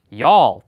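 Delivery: loud, falling "y'all" in one syllable; insistent y'all. Retold or imitated. Steve keeps purposely doing it, insistent "y'all". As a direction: land "y'all" loudly as one stubborn word. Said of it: "he kept saying".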